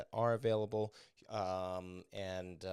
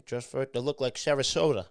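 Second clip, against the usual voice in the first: louder mobster impression; Tony Soprano; 4 semitones higher, 5 dB louder than usual. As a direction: toss it off as a TV mob boss. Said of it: "as Tony Soprano would say".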